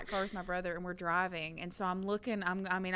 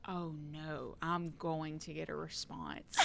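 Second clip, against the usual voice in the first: inner thought; 4 semitones lower, 10 dB quieter than usual. Retold or imitated. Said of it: "And I'm sitting here thinking".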